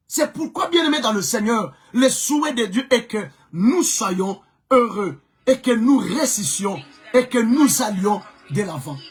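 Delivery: normal talking voice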